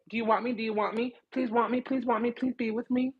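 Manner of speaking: high, soft, wheedling begging; imitating a desperate suitor, high and quiet; mock needy pleading. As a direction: beg in a small, high voice, mocking neediness. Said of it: "So this whole masculine".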